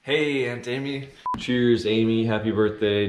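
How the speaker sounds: loud, upbeat, cheering greeting; happy uppity. Acted. Nephew cheers his aunt on her birthday, loud and upbeat. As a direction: raise a toast with bright cheer. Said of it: "You're gonna be a happy uppity".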